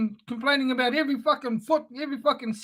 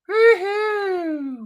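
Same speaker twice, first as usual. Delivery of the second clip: mock cheer; 7 semitones higher than usual. Mocking a jet-setter's fun.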